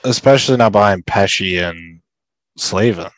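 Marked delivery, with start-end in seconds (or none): none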